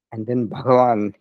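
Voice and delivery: usual speaking voice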